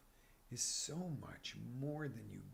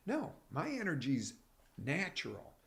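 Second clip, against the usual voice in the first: normal talking voice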